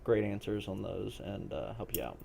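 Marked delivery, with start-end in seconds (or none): none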